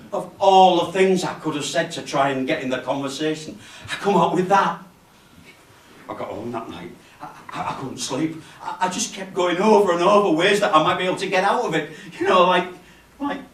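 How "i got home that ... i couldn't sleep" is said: ordinary talk, nothing marked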